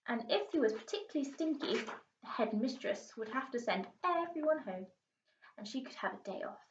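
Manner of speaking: ordinary delivery, nothing marked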